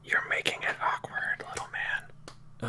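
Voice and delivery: unvoiced teasing whisper back. Real, not acted. Player whispering back at the halfling, teasing. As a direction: whisper the jab to the little man.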